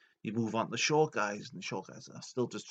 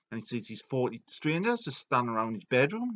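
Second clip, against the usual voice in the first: normal talking voice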